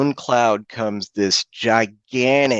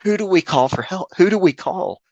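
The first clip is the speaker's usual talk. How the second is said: higher-pitched, mildly frantic questions; imitated panicked fumbling; 7 semitones higher than usual. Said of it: "sitting there fumbling about".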